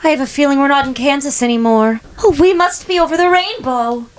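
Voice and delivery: put-on high, breathy old-movie girl; Dorothy Gale voice. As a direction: gush it like a 1930s film heroine. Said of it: "like, Dorothy Gale".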